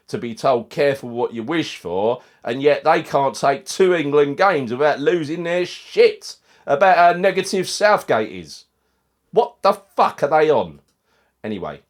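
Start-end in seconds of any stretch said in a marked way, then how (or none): 2.4-10.8 loud, slow, raised outrage; real outrage, loud and drawn out; outraged rant. Real, not acted. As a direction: rant at the hypocrite pundits.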